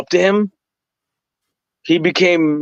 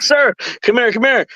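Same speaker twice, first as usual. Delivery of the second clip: much higher, eager mock calling; excited beckoning; 7 semitones higher than usual. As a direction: wave him over with eager urgency. Retelling himself calling the referee.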